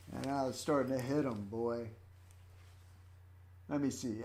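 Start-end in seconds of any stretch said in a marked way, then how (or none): none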